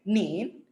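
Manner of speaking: "Nin" sliding upward; rising tone. Shown as a demonstration. Teacher repeats the tone for pupils, "Nin" rising. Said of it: "nin itu kan naik kan".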